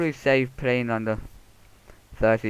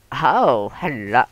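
put-on greeting voice; 3 semitones higher, 10 dB louder, slower than usual. Gamer acting out walking in, loud put-on voice. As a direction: ham up a silly loud hello. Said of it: "bloody accent. Accent? Voice".

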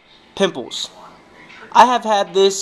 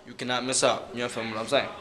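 much softer, lower close; quiet sign-off; 9 semitones lower, 10 dB quieter than usual. Real, not acted. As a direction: drop it to a murmur. Softly closing the plug.

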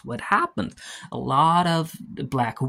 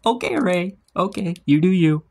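amused teasing; 6 semitones higher, 4 dB louder than usual. Real, not acted. Teasing the character after laughing.

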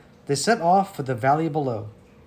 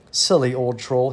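plain reading voice

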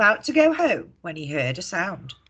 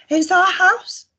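much higher, louder squeaking mouse; squeaky mouse; 13 semitones higher, 8 dB louder than usual. A narrator's reading. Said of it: "squeaked the mouse".

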